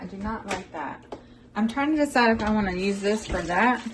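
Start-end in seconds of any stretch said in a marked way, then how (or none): 0.0-1.0 much softer muttered repeat; muttered under breath. Real, not acted. Grumbling to herself about the spill, softly. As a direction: say it again to yourself, hushed.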